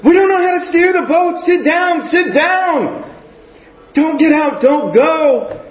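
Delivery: high, anxious plea to sit down; pleading disciples. Retold or imitated. Disciples begging Peter to stay.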